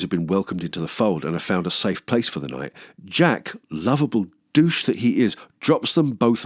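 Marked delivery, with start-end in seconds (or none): none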